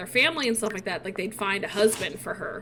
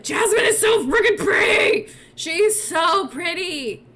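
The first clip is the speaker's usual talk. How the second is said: high, loud burst of adoration; excited gushing; 8 semitones higher, 6 dB louder than usual. Real, not acted. Gamer interrupts herself over pretty Jasmine, gushing. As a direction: squeal it, then slow down to savor it.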